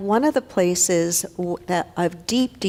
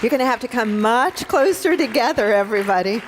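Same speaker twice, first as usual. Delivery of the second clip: raised, higher call to a group; 4 semitones higher, 4 dB louder than usual. Real, not acted. Minister gathering prayer partners up front, calling out. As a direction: call them in closer.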